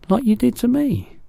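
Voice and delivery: put-on a small girl asking anxiously; high childlike voice; Sophie. A narrator's reading.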